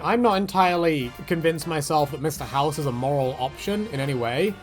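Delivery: ordinary talk, nothing marked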